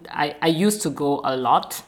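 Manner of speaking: normal talking voice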